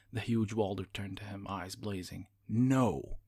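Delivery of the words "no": loud, curt refusal; barked no. A narrator's reading. Walder refusing Magius as he turns away, a loud bark. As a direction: snap it back in anger. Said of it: "he barked".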